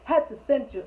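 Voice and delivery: ordinary talk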